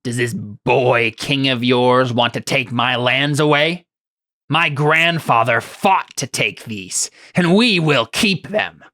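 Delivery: slow, indignant runty king; frowning king. Acted. King Nim refuses to give up his lands, frowning and defiant. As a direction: weigh each word, rising in pitch to a defiant finish. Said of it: "he frowns and he says".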